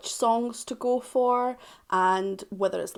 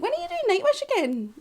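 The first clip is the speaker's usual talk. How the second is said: much higher, quick Scottish lady voice; high-pitched Scottish woman. Retold or imitated. Fans demanding more Nightwish, high Scottish woman voice. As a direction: mimic a shrill-sounding Scottish fan asking. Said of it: "they sound like a high-pitched Scottish woman".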